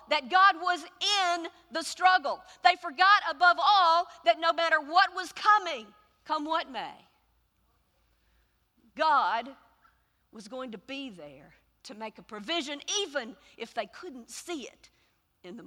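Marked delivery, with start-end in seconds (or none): none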